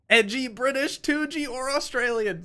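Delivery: high, loud mock-edgy delivery; hammed-up loud voice. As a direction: belt it out high and playful. Hammed-up voice on the nickname.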